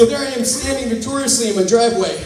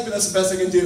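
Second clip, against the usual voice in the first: ordinary talk, nothing marked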